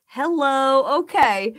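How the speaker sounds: ordinary delivery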